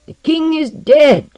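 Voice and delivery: high, loud, slow announcement; ringing proclamation. A narrator's reading. Ambassador answering the protesting king, proclaiming. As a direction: proclaim it like a herald.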